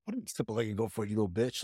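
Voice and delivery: hushed inner snark; inner grumble. Retold or imitated. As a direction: grumble it to yourself, fast. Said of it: "thinking to myself".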